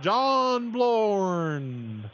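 high, long drawn-out name call; mock loud yell. Shown as a demonstration. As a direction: holler a name across a room, not full volume. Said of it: "yell out real loud".

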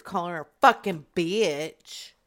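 ordinary delivery

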